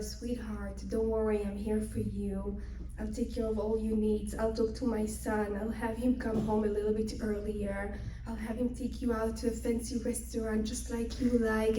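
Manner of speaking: soft, low, coaxing reassurance; soothing father-in-law. Acted. Father-in-law promising to take care of her, soothing. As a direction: comfort her tenderly and quietly.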